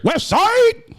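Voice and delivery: high-pitched, whooped shout-out; rallying call. Real, not acted. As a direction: whoop it out as a greeting. Calls out his side when introduced.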